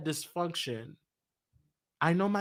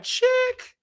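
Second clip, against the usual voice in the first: incredulous exclamation. Retold or imitated. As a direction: call her out in disbelief. Silent retort to his mother.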